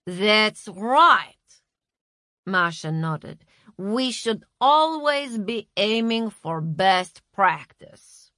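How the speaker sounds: slow, condescending manager; brisk boss voice. A narrator's reading. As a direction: talk down like a boss. Masha pleased.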